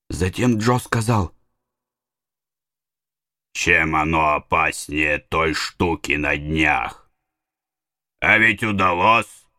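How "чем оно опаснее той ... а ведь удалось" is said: low villain voice. A narrator's reading. Injun Joe voiced in story.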